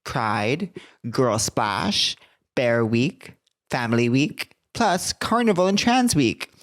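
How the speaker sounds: slow, emphatic tourism promo; hammed-up announcer savoring each item on the list; ad read. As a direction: draw out the sales copy, lifting the big phrases. Reads a tourism ad.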